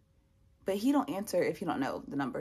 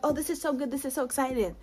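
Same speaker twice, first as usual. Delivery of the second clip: higher, gushing congratulations; excited recruiter; 5 semitones higher than usual. Retold or imitated. Recruiter thinking she passed everything, excited. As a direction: imitate an oblivious, thrilled recruiter. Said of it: "'cause he thought I passed everything".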